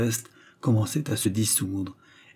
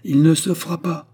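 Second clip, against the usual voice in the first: higher, louder flat refusal; defiant retort; 7 semitones higher, 5 dB louder than usual. A narrator's reading. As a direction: answer back in defiance. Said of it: "répliqua Raymonde, qui était devenu très pâle".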